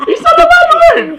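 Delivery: high squeal through real laughter; cracking up, barely getting the words out; helpless high-pitched laughing objection; laughing protest. Real, not acted. Protesting while cracking up at misunderstanding.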